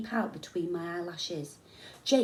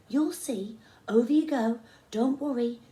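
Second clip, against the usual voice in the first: urging encouragement; 4 semitones higher, 6 dB louder than usual. A narrator's reading. Instructor urging scared boy.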